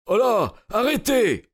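high-pitched shouted challenge; crying out. A narrator's reading. Cyclist blocking the road to Holmes's cart, crying out. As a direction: shout to halt a passing cart. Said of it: "s'écria-t-il".

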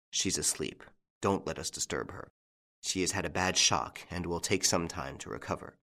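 soft, hushed voice. Acted. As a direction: keep it low not to wake her. Doctor whispers near sleeping girl.

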